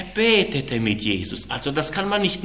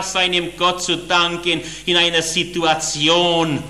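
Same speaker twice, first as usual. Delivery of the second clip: ordinary delivery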